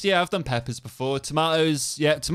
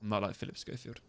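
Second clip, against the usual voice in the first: under the breath; 3 semitones lower, 13 dB quieter than usual. Real, not acted. Repeating denial under breath.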